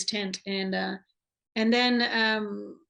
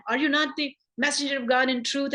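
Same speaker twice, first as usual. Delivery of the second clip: retold indignant question; 5 semitones higher than usual. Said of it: "and he's angry".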